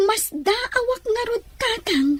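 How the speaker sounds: tearful, breaking voice, slow at first; crying. Acted. Daughter crying to her father over the lost package. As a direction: fight back tears while telling him.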